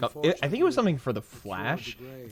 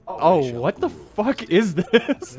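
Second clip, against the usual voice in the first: amused disbelief; 6 semitones higher, slower than usual. Retold or imitated. Quoting own shocked, amused reaction.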